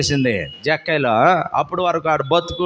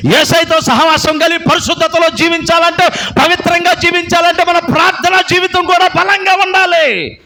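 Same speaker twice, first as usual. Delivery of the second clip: higher, louder preaching at full force; fiery preaching; 13 semitones higher, 7 dB louder than usual. Real, not acted. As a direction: exhort the congregation with fire. Preaching climax.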